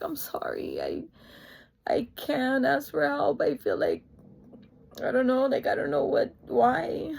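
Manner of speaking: tearful apology, voice jumping in pitch; crying. Retold or imitated. Daughter apologising to parents for not asking, crying. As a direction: struggle through the words, choked with tears. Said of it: "So I just was crying".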